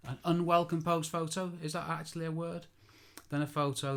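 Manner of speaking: accent slipping a bit posh. Real, not acted. Photographer stumbling over his wording to listeners, in a slightly posh accent. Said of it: "my accent went a bit posh then".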